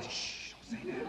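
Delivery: quiet, high hushing warning; hushed shushing. Acted. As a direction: hush the child, nervous. Hushing daughter quickly.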